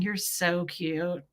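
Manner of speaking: soft, slow, adoring murmur; tender cooing. Retold or imitated. Woman gazing into a lover's eyes, tender. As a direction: draw it out fondly and quietly. Voices gazing lovingly at partner.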